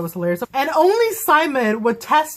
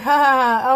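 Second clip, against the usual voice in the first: fake laughter; 3 semitones higher, 4 dB louder than usual. [name]'s sarcastic fake laugh.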